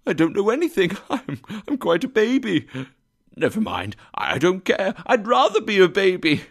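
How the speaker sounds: high, quick, chuckling babble; giddy elation. A narrator's reading. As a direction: babble happily with a little laugh. Scrooge giddy with joy, laughing.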